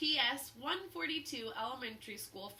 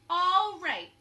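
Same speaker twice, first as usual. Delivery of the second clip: louder, higher smug retort; smug and proud; 4 semitones higher, 8 dB louder, slower than usual. A narrator's reading. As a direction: sure she's clever, so there. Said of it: "she said".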